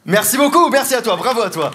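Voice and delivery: high, loud burst of thanks; triumphant thanks. Real, not acted. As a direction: thank them loudly for applause. Triomphe face au public. (remerciement triomphant)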